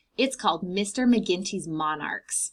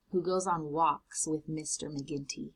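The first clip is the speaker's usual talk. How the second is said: ordinary delivery, nothing marked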